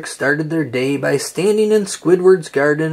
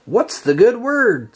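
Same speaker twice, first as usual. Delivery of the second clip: cheerful greeting; 7 semitones higher than usual. A narrator's reading. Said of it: "SpongeBob asked".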